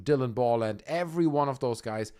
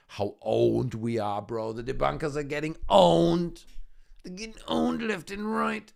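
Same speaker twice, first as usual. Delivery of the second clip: mock believer gloating; 3 semitones higher than usual.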